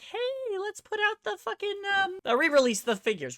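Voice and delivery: high, mocking company voice. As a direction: sneer it like a smug corporate suit. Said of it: "they were like".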